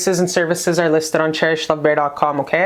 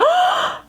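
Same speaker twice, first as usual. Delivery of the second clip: very high delighted gasp; a quick, high, thrilled intake of breath; real excited gasp; sharp excited gasp. Real, not acted. Reacts to drawn card.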